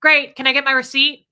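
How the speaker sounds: loud, brusque demand; mock impatient shopper; rude customer. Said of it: "people being rude".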